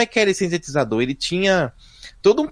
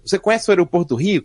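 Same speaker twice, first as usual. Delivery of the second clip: ordinary delivery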